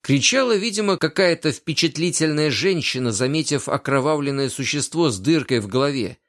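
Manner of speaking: plain reading voice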